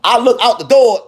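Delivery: plain reading voice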